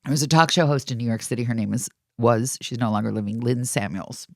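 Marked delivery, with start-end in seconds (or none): none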